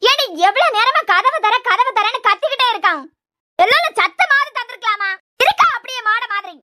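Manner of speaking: high-pitched, exasperated scolding; angry scolding. Acted. Mother berating daughter for not opening the door, angry scolding. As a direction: scold her in a high, pressing voice.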